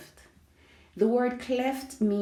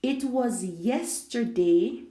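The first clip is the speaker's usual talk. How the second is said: "yesterday" drawn out, higher and rising; stress on yesterday; 4 semitones higher, 3 dB louder than usual. Shown as a demonstration. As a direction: slowly lift the word "yesterday" for emphasis. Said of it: "Let's place emphasis now on the, the word yesterday".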